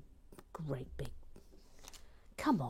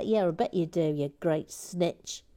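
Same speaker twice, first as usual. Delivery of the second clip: ordinary delivery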